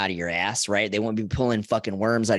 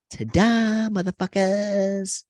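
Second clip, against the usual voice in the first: triumphant ta-da; 8 semitones higher, much slower than usual. Acted. Triumphant reveal of cure.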